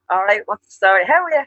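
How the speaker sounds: hearty Dublin how's it going; Dublin greeting. Shown as a demonstration. Dubliner showing her own greeting, in a loud Dublin accent. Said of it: "then you hear me".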